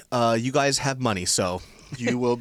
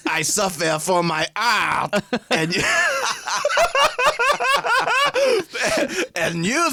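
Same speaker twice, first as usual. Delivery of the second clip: high theatrical French artist voice; hammy French accent, laughing through it; French chef; 8 semitones higher, much slower than usual. Pretend. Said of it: "That's not how we speak".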